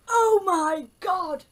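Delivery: slow drawn-out oh my god; surprised. Real, not acted. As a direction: stretch it out in delighted shock. Finds the brownie bear.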